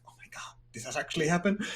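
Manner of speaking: hushed, voiceless aside to oneself; whispered aside. Real, not acted. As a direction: whisper it to yourself in disbelief. Muttered aside to self, doubting date.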